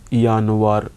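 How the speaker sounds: "Januar" said YA-nu-ar with a y glide; German Januar. Shown as a demonstration. Teacher modelling German month Januar for students. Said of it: "J is like ya sound".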